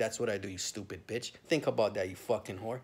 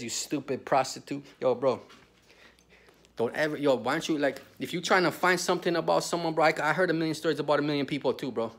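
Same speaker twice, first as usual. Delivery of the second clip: normal talking voice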